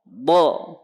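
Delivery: "Bo" as heavy Arabic dad with an o-coloured vowel; demonstrated dod. Shown as a demonstration. Teacher models dod for students, heavy "Bo". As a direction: press the tongue's side to upper molars for "Bo". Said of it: "Baik, perhatikan".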